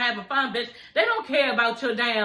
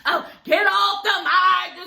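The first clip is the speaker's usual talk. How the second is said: mock annoyed Erica; 6 semitones higher, 4 dB louder, slower than usual. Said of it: "rolling her eyes and".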